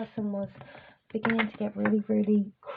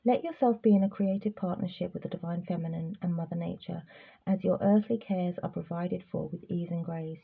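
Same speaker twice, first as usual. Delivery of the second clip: soft, lower oracle reading; card reading; 3 semitones lower, 4 dB quieter than usual. Acted. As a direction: read the guidance gently and softly. Reads card message.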